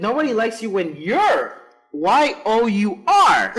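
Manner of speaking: high, loud, slow reading of the misspelled line; laughing mock reading. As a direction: read the mistake out in laughing disbelief. Reading the botched cake lyrics aloud.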